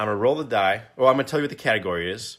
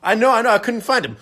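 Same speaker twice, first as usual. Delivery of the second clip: frantic clerk; 12 semitones higher, 9 dB louder than usual. Retold or imitated. Panicked clerk answering the yelling dad.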